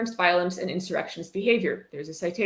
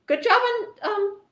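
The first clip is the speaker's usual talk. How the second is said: patronizing praise; 7 semitones higher than usual. Pretend. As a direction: praise him like a small child. Sarcastic praise to author Andy.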